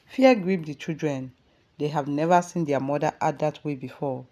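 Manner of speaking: plain reading voice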